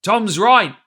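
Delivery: pirate shouting support; shouted backing. A narrator's reading. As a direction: back your mate up hard. Pirate backs Morgan, shouting.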